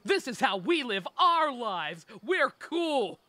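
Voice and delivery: high, drawn-out mock grandstanding; mock a try-hard villain's big declaration; mocking blue-haired villain. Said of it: "The blue-haired one's like".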